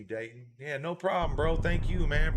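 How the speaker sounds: ordinary talk, nothing marked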